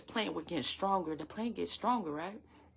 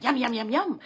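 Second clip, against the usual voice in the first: loud, higher greedy gobbling yums; munching rabbits and deer; 5 semitones higher, 8 dB louder than usual. Acted. Rabbits and deer devouring her harvest, greedy munching. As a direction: gobble it up like hungry critters. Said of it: "rabbits and deers would have been like".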